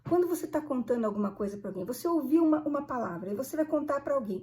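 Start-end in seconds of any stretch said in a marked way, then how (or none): none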